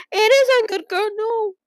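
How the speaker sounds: high, fast, anguished cry; real grief. Real, not acted. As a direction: gasp and break at the loss. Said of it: "I'm gonna fucking cry".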